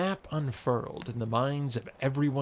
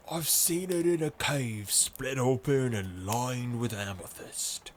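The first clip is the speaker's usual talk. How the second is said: husky revenant voice. Acted. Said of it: "in a husky, revenant voice".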